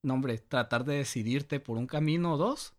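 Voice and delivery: ordinary delivery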